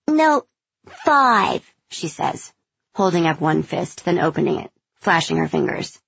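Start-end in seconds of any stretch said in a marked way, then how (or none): 0.1-1.6 put-on high, loud insistent child; a kid pushing her luck; little girl voice. A narrator's reading. Child counteroffer.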